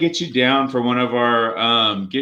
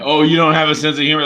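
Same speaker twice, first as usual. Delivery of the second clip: fast, louder mocking tone; mock the critics quickly; mocking whine; 4 semitones higher, 5 dB louder, faster than usual.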